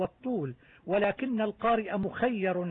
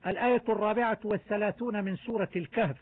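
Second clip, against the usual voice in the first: ordinary delivery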